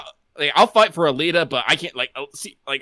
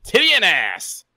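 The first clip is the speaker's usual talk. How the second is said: gleeful shout; 7 semitones higher, 5 dB louder than usual. Pretend. Butting in on the co-host's design talk, gleeful shout. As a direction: crow it out like a crude cheer. Shouted crude interjection over co-host.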